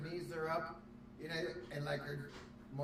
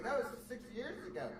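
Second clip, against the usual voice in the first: higher pleading tone; mock defensive guy; 9 semitones higher than usual. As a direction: protest like a caught man. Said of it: "and he was like".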